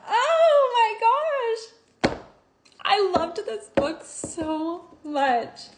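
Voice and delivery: high, very slow, choked-up voice; barely holding back tears; teary and moved. Real, not acted. Reviewer overwhelmed by the ending, speaking through tears. Overwhelmed after the reunion quote.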